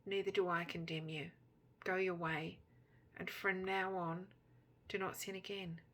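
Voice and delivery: hushed, measured absolution; quietly spoken. A narrator's reading. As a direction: say it quietly, with space between phrases. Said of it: "And he quietly says".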